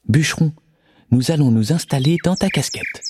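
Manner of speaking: worried little bird voice. Acted. Mother bird voice.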